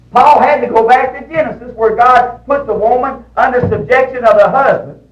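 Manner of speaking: high, loud pulpit delivery; ringing, emphatic preacher's declaration; forceful preaching. Real, not acted. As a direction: proclaim scripture at full voice. Preacher drives home scripture point.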